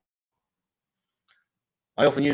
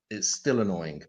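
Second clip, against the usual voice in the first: ordinary talk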